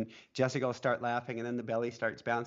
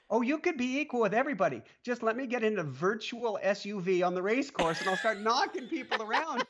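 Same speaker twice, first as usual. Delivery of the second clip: imitating wife; 10 semitones higher than usual. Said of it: "She said".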